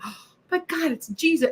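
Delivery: replaying a startled reaction; retold shock. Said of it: "I was shocked".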